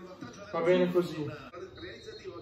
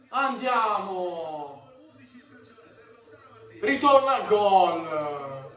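ordinary delivery

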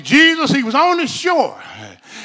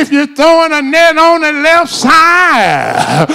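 preaching at full force; 5 dB louder, slower than usual. Acted. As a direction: climactic hooping preacher, loud and sung-out.

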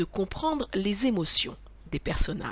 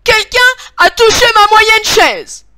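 high, loud, irritated outcry; irritated mama bear; 13 semitones higher, 16 dB louder than usual. A narrator's reading. Mama bear finds her chair was touched, irritated high shout. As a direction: exclaim it sharply, pitched way up, very annoyed. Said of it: "d'une voix irritée, s'écrie".

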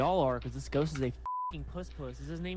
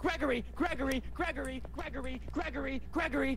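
calling out; 10 semitones higher, 5 dB louder than usual. Real, not acted. Calling to the ghost Gregory, high sing-song calling. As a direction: bellow the name into the dark.

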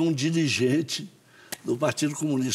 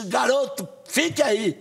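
police order; 7 semitones higher than usual. Retold or imitated. Police chief ordering him to stay.

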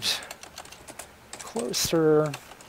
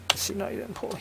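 whispered aside; 7 semitones higher, 6 dB quieter, much faster than usual. Real, not acted. Muttered self-correction aside.

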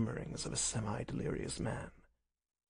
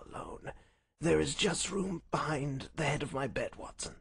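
soft, higher voice fading into breath; feeble invalid Holmes; 4 semitones higher, 5 dB quieter than usual. Acted. Sick Holmes directs his friend behind the bed, feeble voice. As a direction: explain faintly, running out of strength. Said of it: "he spoke in a feeble voice".

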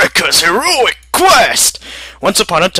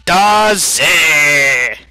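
ordinary delivery

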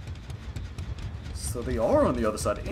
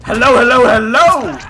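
loud, higher singsong hello shout; taunting shout; 9 semitones higher, 16 dB louder, slower than usual. Real, not acted. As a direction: yell it right in their face. Shouting taunt at caught survivor.

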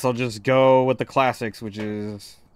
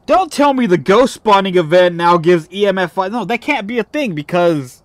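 higher, louder complaining; exasperated rant; 7 semitones higher, 6 dB louder than usual. Real, not acted. Gamer complaining about a ghost event, exasperated rant. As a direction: argue with the game's rules.

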